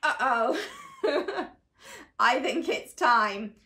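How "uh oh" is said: usual speaking voice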